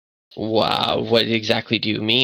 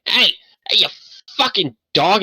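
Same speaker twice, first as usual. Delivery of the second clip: higher, annoyed yell at a dog; 10 semitones higher than usual. Acted. Old man yelling at his dog behind the door, annoyed. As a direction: snap at the barking dog. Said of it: "[name]'s going".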